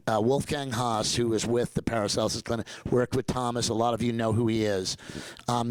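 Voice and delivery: ordinary delivery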